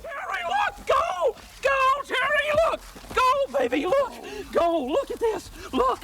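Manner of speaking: high, shouting with excitement; excited shouting. Acted. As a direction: yell out in wild excitement. Finds gold in river.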